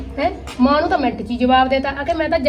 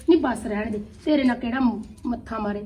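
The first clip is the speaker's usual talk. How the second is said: sulky grumble; 4 semitones lower, 7 dB quieter than usual. Acted. Grumbling to herself after quarrel.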